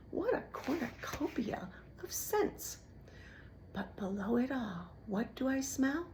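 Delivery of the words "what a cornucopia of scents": breathy, delighted rat whisper; savoring rat. A narrator's reading. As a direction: savor it greedily.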